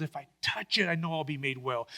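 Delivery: a quiet private vow before reaching out; hushed hope. Retold or imitated. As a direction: murmur it to herself with hope. Woman's private hopeful thought.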